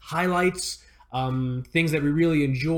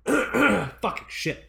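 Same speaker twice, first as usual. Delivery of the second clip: choking mid-sentence; coughing through the words; 7 semitones higher, much slower than usual. Real, not acted. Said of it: "hold on, choking".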